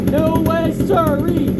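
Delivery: high, loud flat-out denial; emphatic shout. Real, not acted. Fiercely denying the brand's claim.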